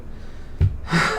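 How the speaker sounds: breathy burst of laughter. Real, not acted. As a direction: crack up at the silly text. Laughs at repetitive passage.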